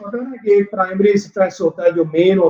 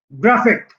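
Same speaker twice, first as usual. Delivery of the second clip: "Graphic" with ग्रा raised, फिक dropped; stress before -ic. Shown as a demonstration. Teacher repeating an IC example, first syllable stressed. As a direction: hit ग्रा, let the rest of "graphic" fall. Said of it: "एक से पहले वाला जो है grab".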